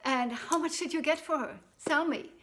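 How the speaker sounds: mother hungry for news of the sale; eager demand. Acted. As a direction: press him for the answer.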